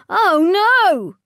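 high, loud frightened wail. Acted. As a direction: cry out in panic. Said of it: "wailed Stegs".

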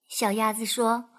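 usual speaking voice

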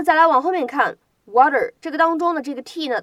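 ordinary talk

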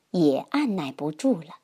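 ordinary delivery, nothing marked

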